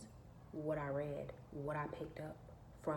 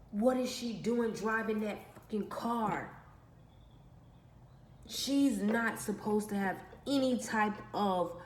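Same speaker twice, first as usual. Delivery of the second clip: lightly acted jealous brother ranting in his head; brother's imagined thoughts; 4 semitones higher, 6 dB louder than usual. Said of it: "is what he said in his head".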